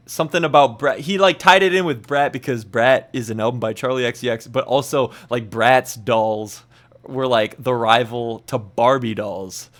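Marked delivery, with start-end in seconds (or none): none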